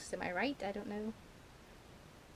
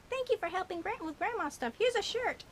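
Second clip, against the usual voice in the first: high mother voice; 10 semitones higher than usual. Retold or imitated. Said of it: "So Mother's like".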